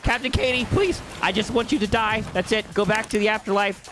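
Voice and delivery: high, panicky, rushed pleading; frantic pleading. Real, not acted.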